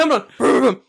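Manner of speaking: short, high engine rev; imitated motorcycle engine. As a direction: rev a bike with your mouth, loud. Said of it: "and I hear this".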